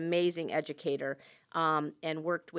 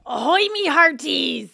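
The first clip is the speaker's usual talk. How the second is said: slow, loud pirate greeting; hearty slow pirate call; 8 semitones higher, 13 dB louder than usual. Pretend. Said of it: "Are you talking like that?".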